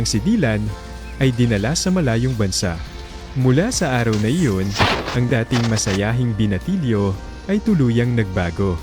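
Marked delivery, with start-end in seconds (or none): none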